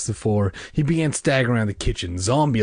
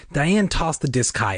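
ordinary delivery, nothing marked

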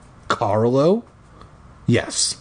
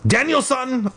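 shrieking; 13 semitones higher than usual. Acted. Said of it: "I shrieked".

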